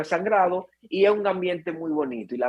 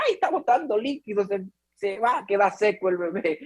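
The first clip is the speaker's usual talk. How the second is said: high-pitched alarmed relative; mock panic; 4 semitones higher than usual. Imitating family alarmed as the waters break, panicky. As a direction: fret in a raised, panicky voice.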